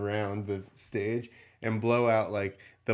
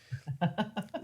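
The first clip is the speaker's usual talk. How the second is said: high breathy laugh; 8 semitones higher, 5 dB quieter than usual. Real, not acted. Laughing at foaming kid.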